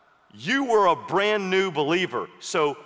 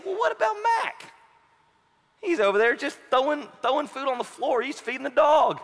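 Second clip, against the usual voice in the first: put-on whiny kid; 8 semitones higher than usual. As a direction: complain like a kid pointing at his little brother. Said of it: "they know they can't say".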